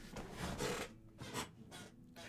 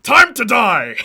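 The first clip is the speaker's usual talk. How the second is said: menacing Venom; 6 semitones higher, 9 dB louder, slower than usual. Retold or imitated. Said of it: "and it's like".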